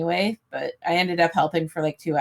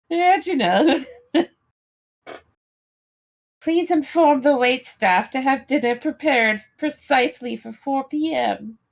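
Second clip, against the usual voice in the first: high, grand lady of the manor; imperious plummy grande dame; 6 semitones higher than usual. Pretend. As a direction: command the staff loftily. Grand lady ordering dinner.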